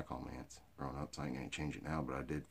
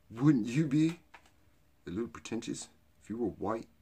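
high, loud and snooty; hammed-up pretentious snob voice; 7 semitones higher, 10 dB louder than usual. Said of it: "with a little pretentiousness on purpose".

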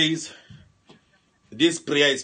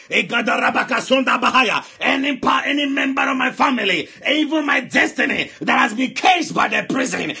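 loud, driving deliverance prayer; fiery preaching; 10 semitones higher, 8 dB louder than usual. Acted. Pastor commanding the congregation's family out of coffins, fiery preaching. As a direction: preach at full force, commanding.

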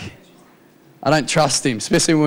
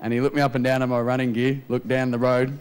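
normal talking voice

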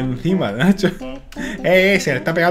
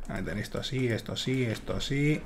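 muttering to himself; 5 semitones lower, 15 dB quieter than usual. Real, not acted. Concentrates while building.

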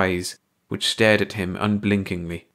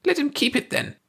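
angry. A narrator's reading. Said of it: "Ursi said angrily".

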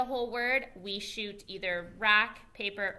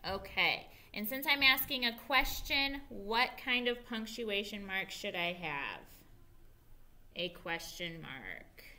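ordinary talk

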